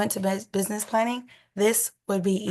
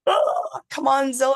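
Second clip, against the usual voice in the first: excited; 5 semitones higher, 4 dB louder than usual. Real, not acted. Hoping the offer gets accepted.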